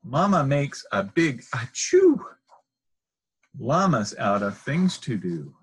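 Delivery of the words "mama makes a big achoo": ordinary delivery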